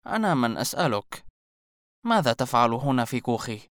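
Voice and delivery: cool, unimpressed retort; cold. A narrator's reading. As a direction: turn the question back on him coldly. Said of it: "أجابته المرأة في برود". (ببرود وتحد)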